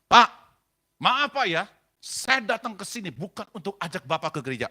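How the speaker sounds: sudden loud, forceful retort; barking back. Retold or imitated. Pastor answering a hostile husband, loud and forceful. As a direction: re-enact a booming apology that overpowers him. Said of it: "Ngomong kayak begini".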